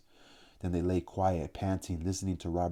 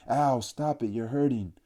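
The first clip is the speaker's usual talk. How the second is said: high, loud yelp of real pain; cry of pain; 5 semitones higher, 4 dB louder than usual. A narrator's reading. Robert crying out to the jabbing boys, high pained yelp. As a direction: beg them to stop, hurting. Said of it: "then in real pain".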